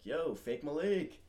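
drawn-out slow "Yo, fake Malik"; slow teasing greeting. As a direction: stretch it out to tease him. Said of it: "and I was like".

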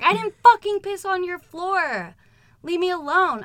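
high, loud, hammed-up yelling back; exaggerated retort. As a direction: shout it back in exaggerated indignation. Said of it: "I might be exaggerating a little bit".

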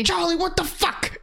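mock [name] scream. [name] screams at who he thinks is Charlie, mock yell. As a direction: yell it like a panicked rapper. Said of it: "starts screaming".

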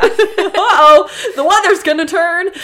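loud laughing uh-oh; laughing mock alarm. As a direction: cackle out the warning.

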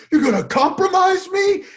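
high shrieking accusation; hammed-up angry shriek; mock screaming match. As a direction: scream it like a reality-show fight.

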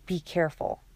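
understated warning hiss; a touch of a hissed caution, kept contained. A narrator's reading. Alec cautioning his companion, lightly hissed warning. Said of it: "Alec hissed".

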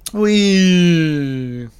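high, loud drawn-out whoop; hammed-up party cheer; mock cheering. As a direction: whoop like someone leaping into a pool. Mimicking diver and cheering pool party.

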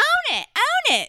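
very high, loud put-on cry; hammed-up shrieked command; Lisa Rinna. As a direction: do an over-the-top housewife impression, punching each repeat. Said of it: "I'll do a Lisa Rinna".

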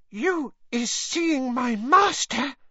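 very high, slow elf question; astonished Winky elf. A narrator's reading. Winky questions Hermione about her master, high and slow. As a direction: ask it slowly, hanging on every word. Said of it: "said Winky".

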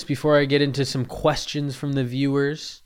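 normal talking voice

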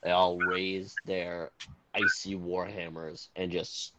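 dramatic scene-setting narration; game master narration. Acted. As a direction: set the scene like a storyteller. GM narrates the ice cave.

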